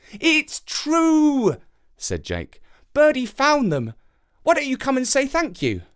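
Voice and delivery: put-on high boy's voice; a boy telling the crow about his dad, in a high child's voice; young boy. A narrator's reading. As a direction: speak like a small boy explaining. Jake insisting.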